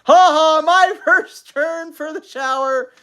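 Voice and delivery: loud, high, gloating shout. A narrator's reading. Father racing into the bathroom ahead of mother, shouting. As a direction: crow it at full voice. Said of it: "shouting at the top of his voice".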